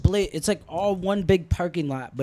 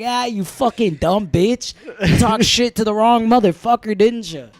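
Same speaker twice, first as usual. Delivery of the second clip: mock gloating; 5 semitones higher, 6 dB louder than usual. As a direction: savor the victory. Imagined gloating at arrested guard.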